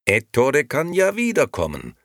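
high, loud, expansive; grand concession. A narrator's reading. Nino allows the uncle back, grandly. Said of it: "erwiderte Nino mit großer Geste".

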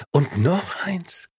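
high, slowed emphatic finish; slow drawn out. Acted. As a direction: stretch the final words out slowly. Last item, slowed.

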